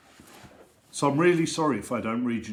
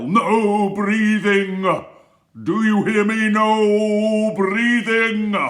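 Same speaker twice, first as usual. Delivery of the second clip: furious, high, slow shouted order; strict teacher yelling; 10 semitones higher, 12 dB louder, much slower than usual. Acted. Strict teacher yelling at the class, furious high shout. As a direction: bellow it at the class like a tyrant.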